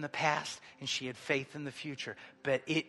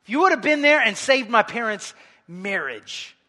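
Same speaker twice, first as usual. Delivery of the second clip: louder, higher preacher's plea to God; impassioned preaching; 5 semitones higher, 11 dB louder than usual. Acted. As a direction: preach it out with force, voicing a believer's reproach. Preaches at full force.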